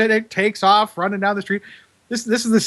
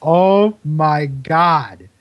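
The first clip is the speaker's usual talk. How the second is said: very slow, drawn-out reaction; retold dismayed discovery; much slower than usual. Imitating assistant opening a box of mice, drawn-out dismay. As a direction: stretch each word out as you imitate his reaction. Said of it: "looks down, you hear him go".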